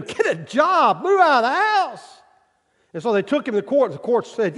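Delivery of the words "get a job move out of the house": high mock yell; fed-up parents. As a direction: nag like exasperated parents. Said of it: "what his parents want him to do".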